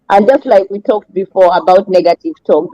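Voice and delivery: ordinary delivery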